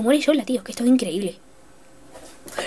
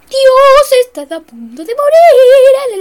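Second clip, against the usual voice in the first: high, loud alarmed wail; panicked cry; 12 semitones higher, 9 dB louder, much slower than usual. Real, not acted. Gamer about to die, crying out in panic. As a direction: panic as the end nears. Panicked at near death in game.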